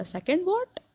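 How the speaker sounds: shocked high-pitched question. A narrator's reading. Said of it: "ग्लिंडन ने shock से बोला".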